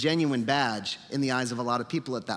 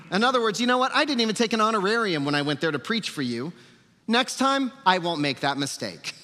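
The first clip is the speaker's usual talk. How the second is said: higher, louder mock grievance; sarcastic indignation; 7 semitones higher, 4 dB louder than usual. Preacher paraphrasing Paul's complaint about an unpaid visit, sarcastically indignant. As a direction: push it up high and loud, then drop for the sarcastic payoff. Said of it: "I hope y'all enjoy sarcasm".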